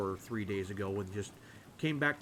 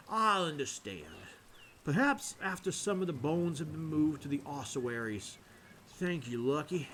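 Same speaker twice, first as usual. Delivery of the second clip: drawling Southern priest from Georgia; deep Georgian accent; 4 semitones higher than usual. Acted. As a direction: play a Father with a heavy Georgia accent. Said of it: "the deep Georgian accent".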